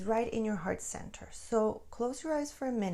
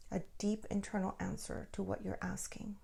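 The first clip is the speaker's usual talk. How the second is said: whispered; 8 dB quieter than usual. Acted. Soft guidance about inner answer.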